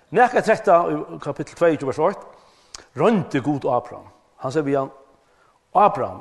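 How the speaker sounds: loud, ringing pulpit reading; preaching the scripture. Acted. Preacher reads Abraham's story to the congregation, loud and ringing. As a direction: proclaim the verses to the congregation.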